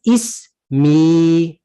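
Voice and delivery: "ismi" with the final "i" held long; slow teaching demo of "ismi", long last vowel; elongated final vowel. Shown as a demonstration. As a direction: stretch the "ee" at the end of "ismi". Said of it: "You're gonna elongate that kasra".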